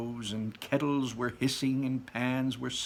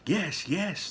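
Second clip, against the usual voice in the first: put-on children's voices; 8 semitones higher than usual. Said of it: "the children agreed".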